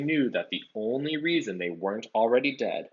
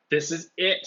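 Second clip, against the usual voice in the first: moaning; 7 semitones higher, 4 dB louder than usual. A narrator's reading. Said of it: "Ron moaned".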